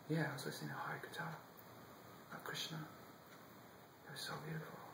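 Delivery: soft, awed reply; a hushed, dreamy answer that ignores the anger; innocent whisper. Acted. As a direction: whisper it, still enchanted. Boy answers the angry guards.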